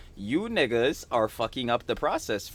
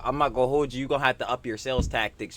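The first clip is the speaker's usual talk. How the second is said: ordinary delivery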